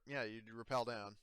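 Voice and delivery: ordinary delivery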